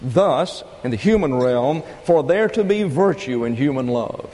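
ordinary delivery

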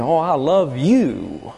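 YOU drawn out with a swooping fall; stress on YOU. Pretend. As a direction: gush it slowly, landing long on YOU. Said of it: "And you say".

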